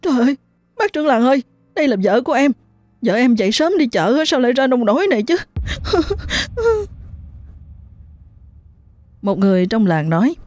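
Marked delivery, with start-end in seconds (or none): 0.0-5.4 high, tearful pleading, rushing at the end; husband weeping as he speaks; crying while talking. A narrator's reading. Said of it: "vừa khóc vừa nói". 5.4-7.2 high, soft drawn-out sob; a husband's falling cry of grief; wailing sob. A narrator's reading. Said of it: "vừa khóc vừa nói".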